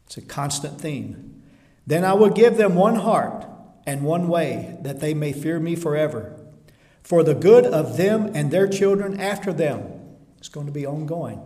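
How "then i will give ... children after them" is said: loud, ringing preaching of the verse; forceful scripture reading. Acted. Preacher booming God's promise to the church. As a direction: proclaim it to the congregation.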